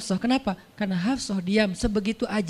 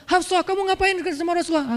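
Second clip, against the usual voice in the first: higher, sharp scolding; scolding father; 7 semitones higher than usual. Acted. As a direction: tell off a daughter. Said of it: "Langsung Umar marah-marah".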